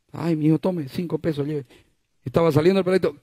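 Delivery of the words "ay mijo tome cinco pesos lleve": indulgent mother. Acted. Mother answering child.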